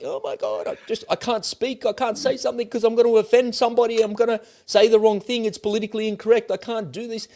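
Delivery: high, whiny, panicked put-on voice; mock panicked man. Vlogger mocking men afraid to speak at work, high whiny panic. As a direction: squeak it out like a man scared of offending. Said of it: "men in the workplace feel like they're like".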